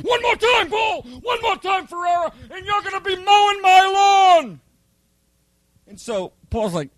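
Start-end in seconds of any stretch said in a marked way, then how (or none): 0.0-4.6 very high, shouted threat; hammed-up drill sergeant shout; screaming drill instructor. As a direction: get right in his face and yell. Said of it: "he's in his face".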